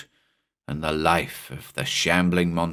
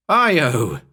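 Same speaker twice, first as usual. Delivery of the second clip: loud, high call to the dog; yelled name; 7 semitones higher, 5 dB louder than usual. Acted. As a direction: shout his name across the beach. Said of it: "I yelled".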